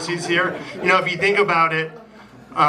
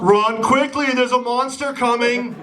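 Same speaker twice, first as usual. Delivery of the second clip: hammed-up urgency; 8 semitones higher than usual. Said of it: "knock-knock joke punchline".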